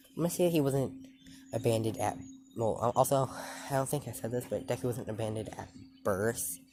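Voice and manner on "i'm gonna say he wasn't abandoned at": ordinary delivery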